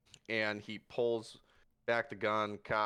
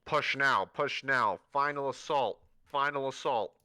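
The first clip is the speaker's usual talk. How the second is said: louder barked command; radio orders; 3 semitones higher, 6 dB louder than usual. Acted. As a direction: bark it over the radio. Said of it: "all everyone hears is".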